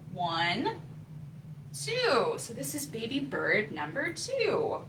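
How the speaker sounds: ordinary delivery, nothing marked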